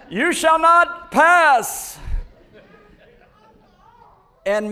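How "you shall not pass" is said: slow, higher and louder, a hammed-up wizard blocking the way; playful grand wizard command, drawn out; mock wizard decree. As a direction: boom it out like a wizard barring the bridge. Booming movie-wizard line as a joke.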